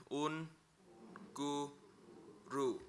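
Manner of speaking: "Un, gu, ru" split into separate syllables with pauses. Shown as a demonstration. Teacher breaking down "Un, gu, ru" for the class. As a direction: sound out "Un, gu, ru" one syllable at a time. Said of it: "we've done this one".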